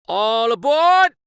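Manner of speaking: loud, high call to passengers; conductor's booming call to board; conductor crying out. Acted. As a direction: call all aboard across the platform. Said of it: "the conductor cried out".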